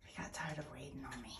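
soft fast whisper aside. Real, not acted. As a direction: whisper it so he won't hear.

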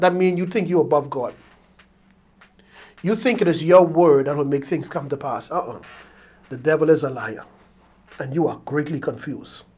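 low, hushed pulpit warning; stern preaching. Real, not acted. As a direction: drop low and soft, weighting each phrase.

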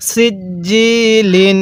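সিজ্জিলিন with a thin s, firm doubled j and long i; thin sin, hard jim. Shown as a demonstration. Teacher models articulation for learners, slow সিজ্জিলিন with thin s and hard j. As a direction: hold the vowel after the hard j in সিজ্জিলিন. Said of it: "সিনটা চিকন করে জিমটা শক্ত করে".

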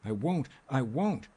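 slow, high-pitched refusal; distraught, insistent protest; distressed cry. A narrator's reading. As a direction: dig in, drawing out each refusal. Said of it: "cried the girl".

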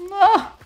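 sudden high yelp of fright; startled yelp. Real, not acted. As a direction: jump and yelp in surprise. Startled while hiding.

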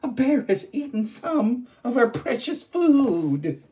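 high, loud shrill-voiced ghost; a cannibal sister complaining aloud. One ghost sister telling the other of the bear, high and loud.

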